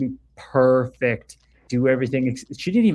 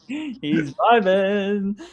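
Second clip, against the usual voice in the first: stretched out slowly in a higher voice; a lazy, floating high drawl; drawn-out vibing; 7 semitones higher, much slower than usual. Acted. Host teasing the moonwalker in a floaty high drawl. A viewer's moon dance joke.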